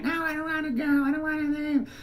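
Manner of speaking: very high mock toddler wail; high-pitched imitation of a crying kid; screaming, crying child. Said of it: "screaming, crying".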